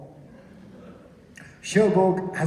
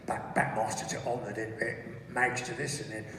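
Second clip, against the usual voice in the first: low, soft muttering to himself; absorbed, half-voiced fiddling with controls; preoccupied co-pilot; 7 semitones lower, 7 dB quieter than usual. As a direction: mumble through a checklist, low and halting. Acting Nick mumbling through a checklist.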